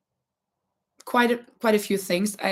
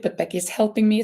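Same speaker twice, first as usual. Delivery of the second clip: ordinary delivery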